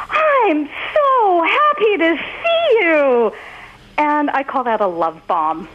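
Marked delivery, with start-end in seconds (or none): 0.1-3.3 put-on high, slow, beaming little boy; happy child. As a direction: gush it like a delighted kid. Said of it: "he's like".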